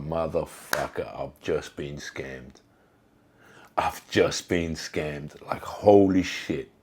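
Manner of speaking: slow, low-key dawning disbelief; stunned realization. Retold or imitated. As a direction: let it sink in slowly, word by word. Dawning realization of being scammed.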